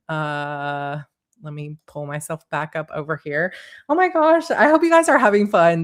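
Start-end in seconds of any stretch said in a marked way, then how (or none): 3.9-5.8 high, loud burst of delight; excited gush. Real, not acted. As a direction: gush to the viewers, thrilled. Host gushing on livestream.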